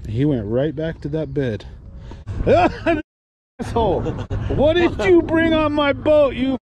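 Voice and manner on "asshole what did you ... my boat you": mock outrage. Scolding friend over banana.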